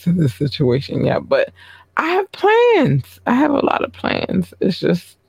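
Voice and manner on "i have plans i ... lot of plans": sing-song tease. Acted. Teasing the audience about plans.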